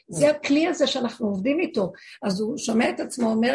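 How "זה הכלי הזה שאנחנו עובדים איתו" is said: usual speaking voice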